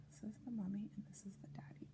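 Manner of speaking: fast hushed whisper; quick whisper. Acted. Whispered aside pointing at picture.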